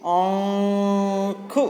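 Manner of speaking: आँख said slowly, vowel through nose and mouth; nasal vowel demo. Shown as a demonstration. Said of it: "जो नाक और मुंह से होता है". (आँख को नाक से धीरे बोलना)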